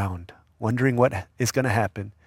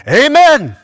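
loud, high startled shout; sudden high-pitched amen; blurted amen; 12 dB louder than usual. Retold or imitated. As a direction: jolt awake and yell it. Sleepy man blurts amen.

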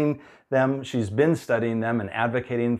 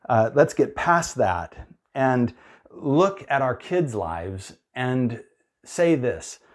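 ordinary talk

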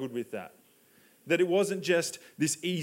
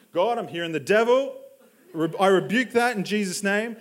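loud, hammed-up prayer of protest; mock rebuke; 3 semitones higher, 6 dB louder than usual. Believer refusing God's request as the devil, loud mock rebuke. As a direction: rebuke it loudly, playing it for laughs.

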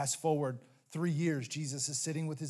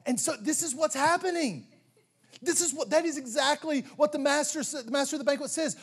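preacher voice, raised and louder; full-force preaching; 9 semitones higher, 5 dB louder than usual. Acted. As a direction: drive the point home from the pulpit.

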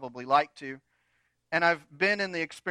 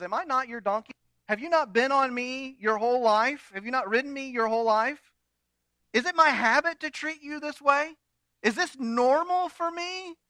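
high, pleading donkey voice rising; the wronged donkey protesting; indignant donkey; 8 semitones higher than usual. Acted.

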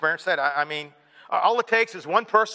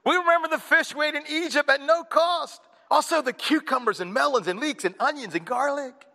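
higher, whiny craving for meat; mock whine, pitched up; whining Israelites; 7 semitones higher than usual. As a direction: wail for the old food like spoiled kids. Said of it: "whining, wailing, and said".

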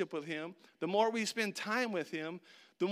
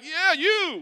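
higher, louder cheer; cheering cheerleaders; 10 semitones higher, 10 dB louder than usual. Retold or imitated. Cheerleaders cheering a player they can't name. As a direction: cheer for someone nameless. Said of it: "They're like".

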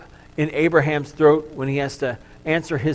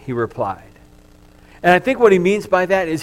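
normal talking voice

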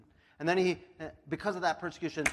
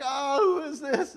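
dazed Saul; 8 semitones higher, 6 dB louder, slower than usual. Retold or imitated. Saul dazed after the light.

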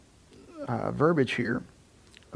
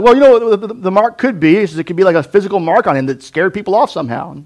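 musing scholar; 6 semitones higher, 9 dB louder than usual. Retold or imitated. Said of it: "Other people are like".

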